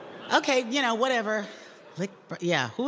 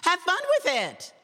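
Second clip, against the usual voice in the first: mocking the producer; 13 semitones higher than usual. Narrator imitating the producer's chirpy note, high mock voice. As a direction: sing it out like a perky boss. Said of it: "She'd give me more notes".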